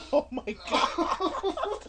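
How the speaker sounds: laughing through pain. Real, not acted. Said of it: "my stomach hurts from laughing".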